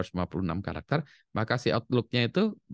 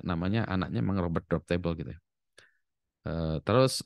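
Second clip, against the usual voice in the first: ordinary talk, nothing marked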